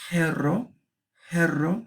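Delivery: jerro with a breathy h at the start; aspirated h. Shown as a demonstration. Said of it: "algo así".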